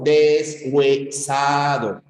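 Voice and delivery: "Deshuesado" slowly split as des-hue-sado; separación por sílabas. Shown as a demonstration. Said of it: "en deshuesado sería así". ("Deshuesado" lento, sílaba por sílaba: des-hue-sado)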